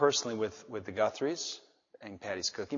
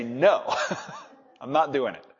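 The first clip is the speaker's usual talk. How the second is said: laughing, louder mock refusal; stubborn refusal; 6 dB louder than usual. Wife to husband, laughing stubborn no. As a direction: play the wife digging in her heels. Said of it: "the way you said it makes me want to just say".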